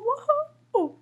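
high, loud cheer; slide whoop. Acted. Friends go down the slide, excited whoop. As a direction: whoop as you take off.

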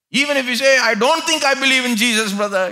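loud, high preaching at full force. Acted. Preacher urging the congregation away from doubt, full force. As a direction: preach it out to the crowd.

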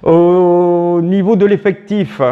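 normal talking voice

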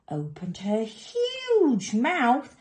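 "huge" drawn out long and high; stretched HUGE. A narrator's reading. Storyteller shows mouth opening, "huge" stretched high. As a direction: swell right up on the word huge.

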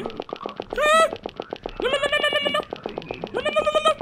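very high frantic nos; high panicked squeal. Real, not acted. Streamer squealing while running from monster. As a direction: squeal in fear. Still fleeing in maze.

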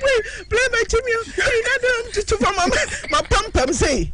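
high, fast wailing mock child cry; mock panicked child. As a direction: squeal like a scared child at a plane. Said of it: "Ɔsi".

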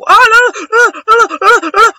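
loud, high-pitched panic; panicked wailing. Real, not acted. Gamer getting killed in a match, loud high panicked wail. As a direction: wail a rapid string of alarmed cries.

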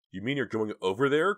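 high, loud shocked cry; horrified cry. Acted. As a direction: cry out in horror. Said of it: "cried Dina, horrified".

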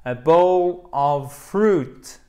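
very slow, loud, each word stretched; emphatic slow demonstration. Shown as a demonstration. Teacher drills the menu words for learners, loud and drawn out. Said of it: "c'est hyper important".

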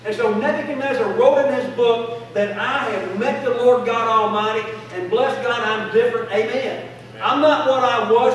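a revival preacher's rising call and response; preaching at full force. Acted. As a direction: drive it home to the congregation. Preaching build-up.

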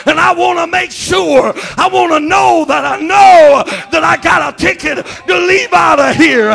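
loud, high, half-sung rapture warning; whooping pulpit climax; preaching at full force. Acted. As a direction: build to the peak and hold it.

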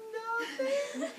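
very high sing-song innocence; exaggerated high fake innocence; innocent Kronos. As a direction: squeak out a guilty denial. Kronos feigns innocence, laughing.

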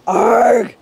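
loud startled bear roar; gruff pained shout; shouted arg. Acted. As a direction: roar it as you bump your head. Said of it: "he shouted".

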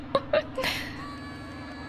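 unvoiced squealing giggle; real helpless high laugh; high wheezing laugh. Real, not acted. Gamer cracks up at guests screaming on the ride, wheezing.

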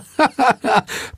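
high, silly giggle; high staccato cackle; laughing at own joke. Acted. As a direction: crack up at your own quip.

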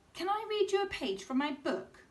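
put-on high eager little girl; high eager child; girl's voice. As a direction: ask hopefully like a child. Girl asks mum.

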